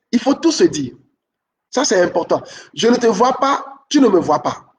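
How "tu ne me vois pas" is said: ordinary talk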